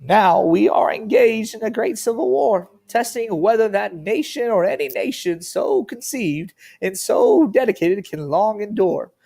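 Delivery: high, slow, drawn-out presidential oratory; Abraham Lincoln. Acted. Teacher reads the Gettysburg Address to the class, put-on Lincoln voice. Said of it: "do my best Abraham Lincoln voice".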